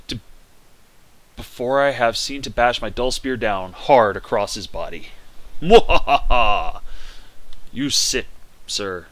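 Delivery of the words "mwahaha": loud slow evil cackle; mad scientist. Pretend. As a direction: cackle like a triumphant villain. Triumphs in a duel.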